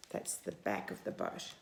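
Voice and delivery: hushed explaining aside; whisper. Real, not acted. Reading to a child, whispering what the stern is.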